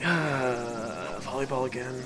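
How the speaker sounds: ordinary talk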